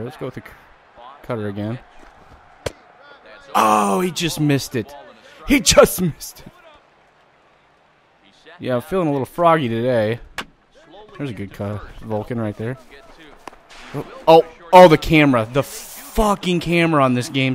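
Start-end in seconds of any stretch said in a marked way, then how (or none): 3.5-6.5 slow, high, loud lament; high anguished groan. Real, not acted. Near miss lament. 13.9-17.5 frustrated yell. Real, not acted. Frustrated at game camera.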